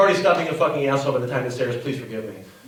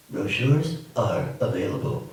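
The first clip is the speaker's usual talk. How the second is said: slow, lower mock announcer; quoting an ad; 9 semitones lower, slower than usual. Narrator mocks another stock brochure line, slow and low. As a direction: drag out the stock phrase slowly. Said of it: "Or what about this one".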